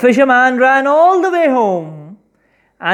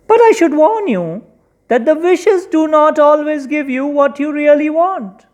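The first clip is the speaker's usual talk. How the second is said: lightly raised fish voice; a touch of fish voice; 7 semitones higher than usual. Acted. Fish bargains for its freedom, lightly higher voice. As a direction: offer the wishes a little higher.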